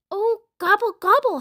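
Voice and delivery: high, howled turkey gobble; howling. A narrator's reading. Turkey is sent flying away empty-handed, howling high. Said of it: "howled Turkey".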